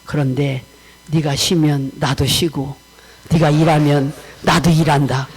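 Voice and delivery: low, slowed voice of God; solemn retold divine reply; God speaking. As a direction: lay down the terms as God, deep and deliberate. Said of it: "하나님께서 그 다음에 나한테 이렇게".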